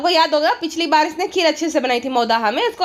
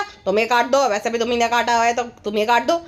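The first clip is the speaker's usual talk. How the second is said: much lower, dopey mock husband voice; 5 semitones lower than usual. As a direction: answer like a henpecked man. Husband voice again.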